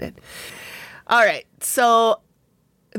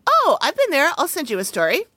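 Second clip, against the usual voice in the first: bright friend voice; 9 semitones higher than usual. Retold or imitated. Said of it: "my friend Heather was like".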